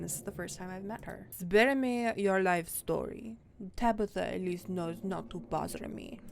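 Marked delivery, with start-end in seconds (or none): 1.3-6.2 bored, haughty cat in bad French accent; disdainful French-accented cat. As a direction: brush them off in a mock French accent. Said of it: "Frou Frou has a French accent".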